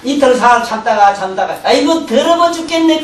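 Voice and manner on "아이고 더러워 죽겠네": 3 finally let it burst out after holding back; 1 blurted complaint. Retold or imitated. 참다가 터져 나온 불평. (4 참다 참다 터뜨리는 불평)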